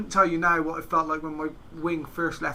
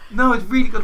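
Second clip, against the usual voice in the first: louder, higher insistence; insisting friend; 6 semitones higher, 10 dB louder than usual. Retold or imitated. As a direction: press the point, friend won't give up. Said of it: "He's like".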